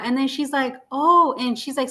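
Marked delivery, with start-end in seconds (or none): 0.9-1.3 higher, louder "oh" of dawning realization; retold realization. Doctor realizing she used the wrong word, retold surprised realization. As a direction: imitate someone suddenly catching on.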